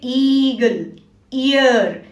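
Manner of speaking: ईगल, ईयर with a drawn-out "ee"; long E sound. Shown as a demonstration. Teacher showing pupils long E, drawn-out "ee" in ईगल, ईयर. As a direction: stretch the first "ee" of ईगल and ईयर slowly. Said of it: "बड़ी ई जैसा स्वर निकलता है".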